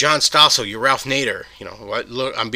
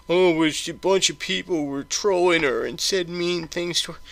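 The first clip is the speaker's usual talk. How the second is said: slow, higher mock-whiny parody of Joe; mocking whiny quote; 6 semitones higher, slower than usual. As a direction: drag it out like a sarcastic impression.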